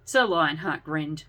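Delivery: usual speaking voice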